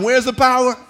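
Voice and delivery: usual speaking voice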